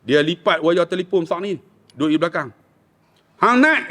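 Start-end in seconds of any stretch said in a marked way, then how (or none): 3.4-3.9 high-pitched stern order; fierce command. Retold or imitated. Father ordering swimming son out of river, fierce high command. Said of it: "Ayah saya garang".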